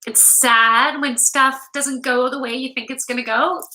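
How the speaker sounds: ordinary talk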